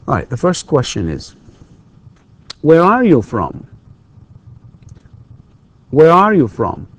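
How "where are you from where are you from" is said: high on "Where are", dropping through "you from"; falling intonation. Shown as a demonstration. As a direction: let "Where are you from" fall to the end. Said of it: "it's falling intonation".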